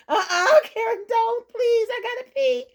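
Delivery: fighting back laughter; laughing through words. Real, not acted. Telling how Mary begged, while laughing.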